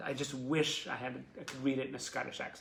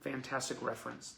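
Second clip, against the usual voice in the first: ordinary delivery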